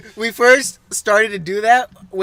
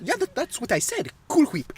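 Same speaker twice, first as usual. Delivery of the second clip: higher, soft character voice with breathy "Whip"; Stewie; 6 semitones higher, 8 dB quieter than usual. Acted. Stewie insisting to Brian, higher soft voice. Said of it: "You literally say it exactly like him".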